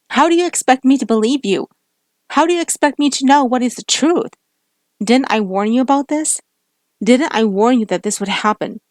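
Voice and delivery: loud, high accusing yell; angry shout. Acted. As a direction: throw the questions at him. Heated lovers' quarrel.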